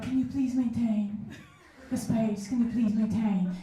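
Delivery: lightly feminine, sweetened ask; a hint of a girly voice; mock feminine. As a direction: ask nicely in a put-on feminine way. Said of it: "I decided to be more feminine about it".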